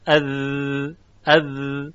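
اذ with a long buzzing voiced th, vocal cords vibrating; drawn-out voiced ذ sound in اذ; voiced dhal demo. Shown as a demonstration. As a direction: hold the ذ in اذ so the voicing buzzes. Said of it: "حالا ذال رو تلفظ کنید".

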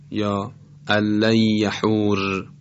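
usual speaking voice

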